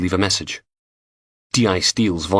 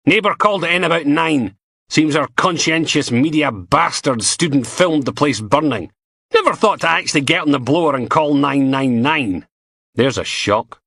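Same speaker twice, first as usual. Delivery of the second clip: higher, dry sarcastic boss; sardonic inspector; 7 semitones higher, 3 dB louder than usual. A narrator's reading. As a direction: scoff at the useless student. Steele briefing, sarcastic.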